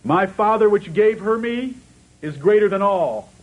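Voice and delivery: high, loud, ringing preacher's voice; impassioned sermon delivery quoting Jesus's promise; full-force preaching. Real, not acted. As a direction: preach it out, building with fervour.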